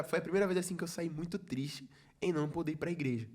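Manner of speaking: ordinary talk, nothing marked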